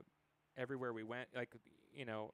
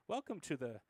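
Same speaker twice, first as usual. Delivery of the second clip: mock welcome; 6 semitones higher, 7 dB louder than usual. Sarcastic mock greeting at fortified hotel.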